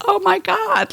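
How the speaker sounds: mock-exasperated cry at oneself; retold dismay. Client retelling getting teary over her job, high dismay.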